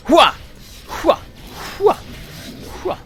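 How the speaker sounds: short sharp effort shouts; silly attack grunts. Real, not acted. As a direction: grunt with each throw. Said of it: "It was the silly noises".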